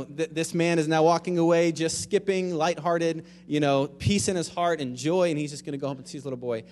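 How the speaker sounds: ordinary delivery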